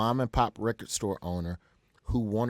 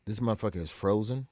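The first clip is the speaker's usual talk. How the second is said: usual speaking voice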